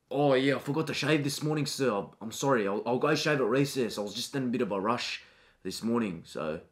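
low, soft, mumbling schoolboy; timid student. Acted. Shy boy answering a pushy teacher, soft and low. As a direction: shrink away from the teacher, quietly. Student excuses his beard.

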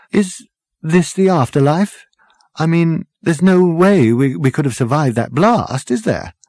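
high-pitched, hesitant disbelief; bewildered. A narrator's reading. Arthur asks whether they are dead, bewildered. Arthur struggles to grasp death.

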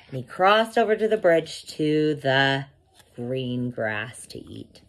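usual speaking voice

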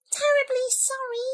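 high, meek little voice; apologetic mouse. A narrator's reading. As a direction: squeak a polite apology. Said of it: "murmured the Mouse".

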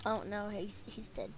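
ordinary talk

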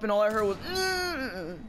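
imitated moan.

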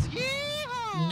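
high whooping yell; cowboy whoop. Real, not acted. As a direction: let out a wild cowboy cheer. Charging in for revenge.

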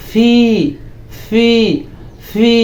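"ফি" with a strong puff of air, long ee; P pronunciation demo. Shown as a demonstration. Teacher stresses an important letter sound, aspirated P demo. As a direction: drill "ফি" in separate aspirated takes. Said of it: "উচ্চারণটা হবে".

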